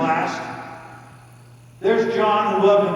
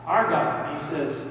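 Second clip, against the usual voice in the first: usual speaking voice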